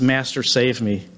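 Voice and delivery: ordinary delivery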